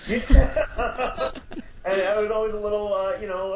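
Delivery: laughing high through the words; laughing recollection. Real, not acted. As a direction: giggle through it. Laughing at the memory.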